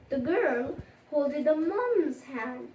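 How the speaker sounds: usual speaking voice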